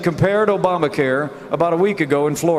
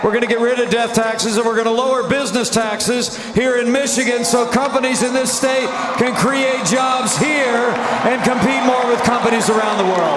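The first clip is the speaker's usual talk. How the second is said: rally speech; 7 semitones higher than usual. Real, not acted. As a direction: rouse the crowd, voice raised. Rally promises shouted.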